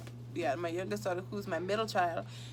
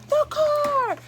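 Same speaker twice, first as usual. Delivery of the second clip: retold scream; 6 dB louder than usual. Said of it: "jumped up and screamed".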